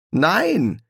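high, emphatic denial; contradicting. A narrator's reading. Crowley denies running someone over, firmly contradicting. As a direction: reject the accusation outright. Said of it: "widersprach Crowley".

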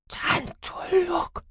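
very slow, high-pitched murmur; drawn-out, strained high voice; delirious murmur. A narrator's reading. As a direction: murmur it as a dazed patient. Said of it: "murmelte sie".

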